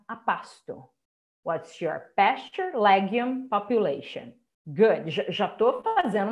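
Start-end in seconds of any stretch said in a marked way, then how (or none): 1.4-4.3 slow model, PASTURE, LEGUME, POPULATION each set apart; teacher's slow drill, pausing between pasture, legume and population; stressed syllables marked. Shown as a demonstration. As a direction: stress PAS-ture, LEG-ume, popu-LA-tion. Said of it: "as estressadinhas tá sublinhado".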